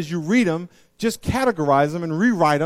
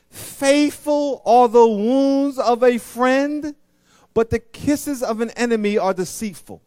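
slow verse reading; 6 semitones higher, 4 dB louder, slower than usual. Acted. Preacher reads verse slowly.

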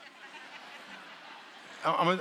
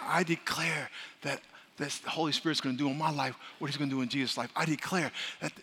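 walking along; 2 semitones lower, 7 dB quieter than usual. Retold or imitated. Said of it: "you're saying".

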